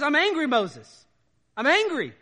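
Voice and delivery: God telling Moses he is angry, loud. Acted. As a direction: declare God's anger with weight. Preacher voices angry God.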